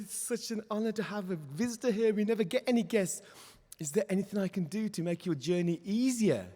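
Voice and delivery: high, eager rabbit voice; thrilled and keen to help; excited welcome. Acted. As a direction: gush to an honored guest. Rabbit honored to have a visitor.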